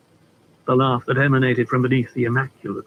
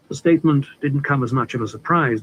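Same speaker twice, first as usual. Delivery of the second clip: usual speaking voice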